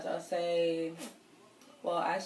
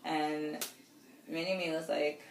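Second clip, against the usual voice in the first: usual speaking voice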